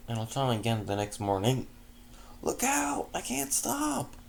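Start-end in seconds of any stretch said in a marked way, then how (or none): 2.4-4.1 high, frantic warning cry; panicked shout. Acted. Stallion in a runaway carriage, shouting high and frantic. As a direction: yell it as you lose control. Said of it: "a male voice exclaimed".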